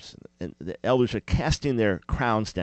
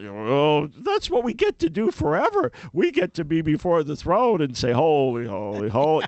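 sarcastic mock delight; 4 semitones higher than usual. Mocking tone of heaven.

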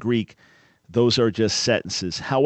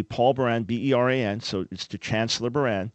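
usual speaking voice

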